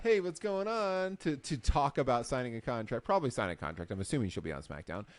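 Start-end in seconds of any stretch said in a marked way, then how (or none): 0.0-1.2 breezy high slow hello, mock-casual; lightly voiced wrestler strolling into an office; casual greeting. Jade Cargill walking into the office, a high breezy hello. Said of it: "walking into Nick Aldis's office going like".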